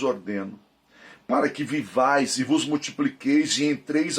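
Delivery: solemn, measured Bible reading; scripture reading. Acted. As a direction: read the verses with weight. Reads Deuteronomy aloud.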